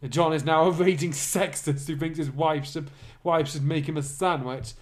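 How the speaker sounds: normal talking voice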